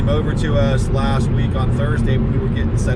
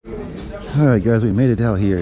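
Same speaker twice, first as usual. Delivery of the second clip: ordinary delivery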